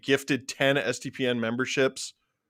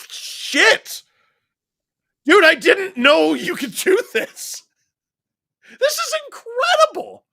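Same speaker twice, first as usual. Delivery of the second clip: much higher, louder, yelling in joy; overjoyed shouting; 8 dB louder than usual. Real, not acted. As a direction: shout it out, totally overwhelmed. Flood of gifted memberships.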